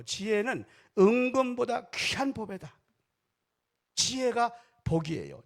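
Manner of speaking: high, loud, slow proclamation; emphatic preaching. Acted. Pastor proclaiming to the congregation, emphatic.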